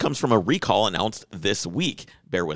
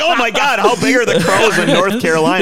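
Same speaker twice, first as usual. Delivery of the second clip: high, loud mock astonishment; squealing mock shock at the crows; mock amazement; 11 semitones higher, 8 dB louder than usual. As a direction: gasp it out, wide-eyed at giant crows. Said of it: "I was imagining".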